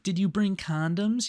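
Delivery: put-on high girlish question; decoy girl voice. The decoy asking the man on arrival. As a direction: ask it sweetly like a young girl. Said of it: "the decoy's like".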